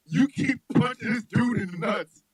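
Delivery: normal talking voice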